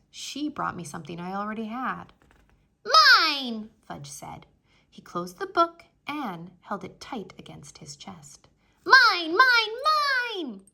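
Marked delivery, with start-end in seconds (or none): 3.0-3.7 high, loud grabby cry; shrill little-kid claim; possessive toddler. Acted. 8.9-10.6 high, loud chanting; high little-kid tantrum chant; possessive toddler. Acted. Toddler clutching book.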